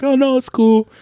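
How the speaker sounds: high, breezy imitated reply; mock coworker reply. Coworkers telling him it's fine, high breezy imitation. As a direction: brush it off lightly as a coworker.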